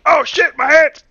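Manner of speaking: high, loud comic cry of pain; mock pain yell. Imagining Crow swinging from broken glass, mock agony. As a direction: yell as if suddenly cut. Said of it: "When he swings from the broken glass".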